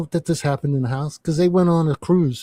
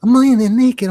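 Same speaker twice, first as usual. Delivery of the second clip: louder emphatic; 9 semitones higher, 9 dB louder than usual. Real, not acted. Repeating shocking detail.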